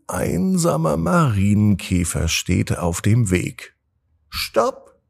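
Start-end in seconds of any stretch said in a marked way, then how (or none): 4.3-5.0 hohe Marienkäferstimme, ruft; ladybug voice. A narrator's reading. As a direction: kleiner Käfer schreit Stopp. Sprechender Marienkäfer ruft Stopp. (hohe Käferstimme)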